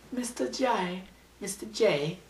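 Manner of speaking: high, whiny, pouting Mister J calls; high sulky cartoon whine; pouty Harley Quinn. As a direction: pout it like a clingy cartoon girl. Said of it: "like that, where she, like, kinda pouts".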